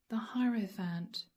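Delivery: hushed unvoiced whisper. Real, not acted. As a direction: breathe the card name softly. Card revealed in a whisper.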